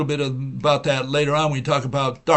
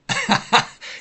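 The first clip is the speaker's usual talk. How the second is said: amused laugh. Real, not acted. Laughs at own impression.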